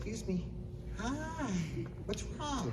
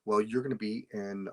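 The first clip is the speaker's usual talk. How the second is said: normal talking voice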